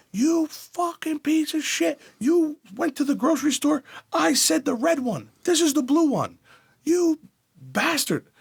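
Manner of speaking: screeching mock tirade at husband; mock berating grandmother. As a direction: berate him like a raving old woman. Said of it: "She used to berate my grandfather".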